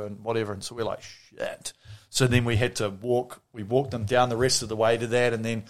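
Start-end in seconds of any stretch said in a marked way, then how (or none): 1.0-1.7 unvoiced whisper; whispered shit. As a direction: breathe it out dismayed. Caught by cops.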